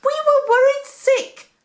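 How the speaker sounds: very high, loud frantic scolding; a mother scolding her lost son. A narrator's reading. Mary scolds Jesus after the frantic search, high and loud. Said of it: "Mary asked".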